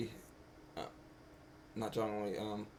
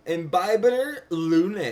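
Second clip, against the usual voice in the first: loud, high, drawn-out questioning rise; hammed-up astonished name call; incredulous recognition; 7 semitones higher, 11 dB louder, slower than usual. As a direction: stretch the name out in mock disbelief. Retold stunned recognition of a character.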